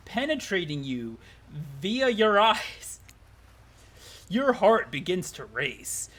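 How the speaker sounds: slow, sultry-dramatic storytelling; hammy visual-novel narrator; story narration. A narrator's reading. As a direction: draw out key words with suspenseful pauses. Reads game narration aloud.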